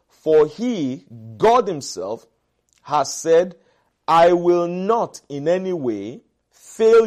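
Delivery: slow, weighty declamation with long pauses; emphatic scripture reading. Acted. Preacher reading God's promise to the congregation, emphatic. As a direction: proclaim each phrase firmly, phrase by phrase.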